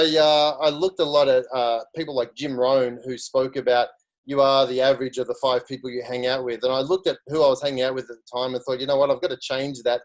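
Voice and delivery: ordinary talk, nothing marked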